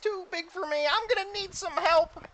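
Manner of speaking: very high-pitched overwhelmed helper; Mister Meeseeks. Acted. Game master as Meeseeks facing too big a task, high Meeseeks voice. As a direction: worry in a high cartoon voice. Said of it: "He summons another Meeseeks".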